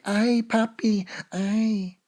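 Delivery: imitating a lover in bed, high and slow; mock Spanish-speaking lover. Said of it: "she's gonna be like".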